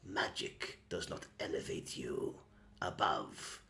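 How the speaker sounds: hushed, threatening whisper; cold whispered warning; menacing whisper. Acted. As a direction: lean in and hiss it quietly. Menacing kobold lowers voice.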